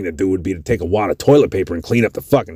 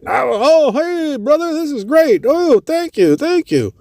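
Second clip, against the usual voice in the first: much higher, delighted thanks; grateful homeless man; slower than usual. Retold or imitated. Homeless man thanking the band for whiskey, overjoyed. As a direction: gush thanks like it's a miracle.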